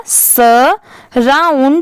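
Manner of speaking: Surrounded very slowly in parts, "sa... round... ed"; broken into syllables. Shown as a demonstration. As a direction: sound out surrounded piece by piece. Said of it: "तो पूरा क्या हो जाएगा". (Surrounded को तोड़-तोड़कर, बहुत धीरे)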